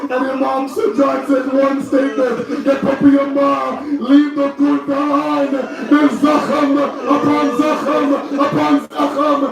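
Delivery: very high, wailing mourning sermon; lament preaching. Acted. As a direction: cry out the tragedy at full intensity, pitch climbing.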